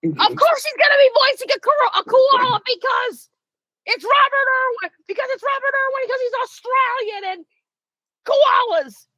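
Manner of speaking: high, gleeful and emphatic; excited. Real, not acted. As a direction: squeal it out in delight. Gleeful rant about Robert Irwin.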